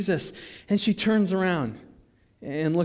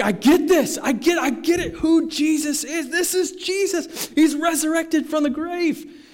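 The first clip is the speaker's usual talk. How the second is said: high, amazed, excited exclamation; epiphany; 11 semitones higher, 5 dB louder than usual. Acted. Mary grasping that Jesus is risen, thrilled amazement. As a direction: marvel at it, pitch soaring. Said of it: "the epiphany on her face".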